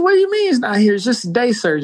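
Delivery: high, startled question; shocked he's gone, pitch leaping up; alarmed disbelief. Retold or imitated. Asking hospital staff where his friend went, alarmed and high. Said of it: "I said".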